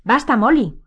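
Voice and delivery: high, loud, firm command; firm exclamation. A narrator's reading. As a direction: cut her off firmly. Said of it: "exclamó Lupin con firmeza".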